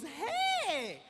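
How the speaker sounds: high, drawn-out fan greeting; imitated fangirl. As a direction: imitate an excited girl's long hello. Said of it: "the girl turns around ... she goes".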